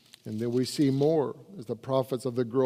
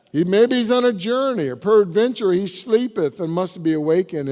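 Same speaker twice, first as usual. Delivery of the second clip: louder, higher preaching cry; full-force preaching; 5 semitones higher, 7 dB louder than usual. Acted. Preacher reading Elijah's taunt, in a loud, raised preaching voice.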